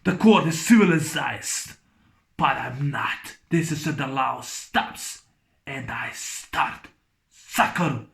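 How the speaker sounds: low, slow, quiet menace; Cobra's growl. Retold or imitated. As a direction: drop the voice low, draw it out. Repeats Cobra's line lower.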